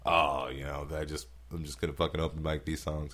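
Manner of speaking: low, understated imitation of apologizing; mock apologetic. Comedian imitating Sandler at an open mic, mock apologetic. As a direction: play down the act with a sheepish apology. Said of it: "he's like, apologizing".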